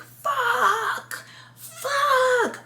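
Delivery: high, cracking yelled curse; hammed-up anguished yell; mock screaming. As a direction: ham up a desperate scream. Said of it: "like, screaming".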